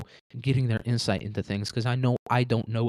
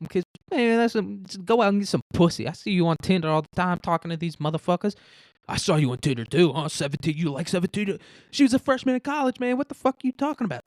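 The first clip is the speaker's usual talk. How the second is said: put-on much higher taunting young guy; mock young guard; 7 semitones higher than usual. Young guard mocks the hostage, high and taunting. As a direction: needle him in a high voice. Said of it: "they're arguing back and forth".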